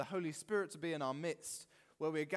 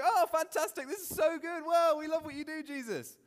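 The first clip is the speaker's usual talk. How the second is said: high, loud mock-gushing crowd; hammed-up fawning excitement, way up in pitch; gushing praise; 13 semitones higher, 10 dB louder than usual. Crowd after seeing the miracle, gushing.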